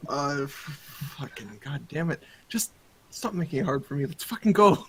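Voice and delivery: put-on grumbling in a Canadian accent; Canadian accent, pleading with a buddy. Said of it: "my Canadian".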